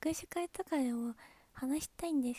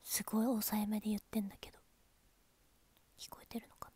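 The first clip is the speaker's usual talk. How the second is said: hushed; 3 semitones lower, 4 dB quieter, faster than usual. Real, not acted.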